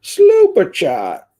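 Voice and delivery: high, loud, drawn-out announcement; calling out. Pretend. Streamer announcing a slooper chat, high drawn-out call. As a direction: sing out the call, way up high and stretched.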